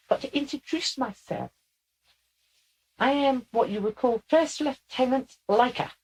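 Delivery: put-on high, squeaky young officer; Leica. Channeled lieutenant introduces herself to the sitters, high and squeaky. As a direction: introduce yourself formally in a squeaky voice. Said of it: "somebody that has a very squeaky voice".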